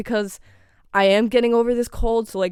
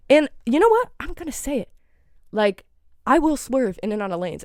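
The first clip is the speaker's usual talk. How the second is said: normal talking voice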